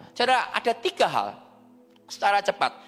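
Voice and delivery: ordinary talk, nothing marked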